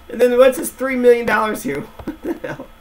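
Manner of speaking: high, incredulous laughing gasp; surprised laugh. Real, not acted. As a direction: blurt it out in disbelief. Shocked at three million in loans.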